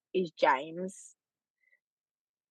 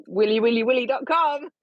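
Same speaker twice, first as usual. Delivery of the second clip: higher, louder jokey web address; mock website announcement; 5 semitones higher, 5 dB louder than usual. Woman teasing partner about a website name, mock announcement. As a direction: announce a silly website like a plug.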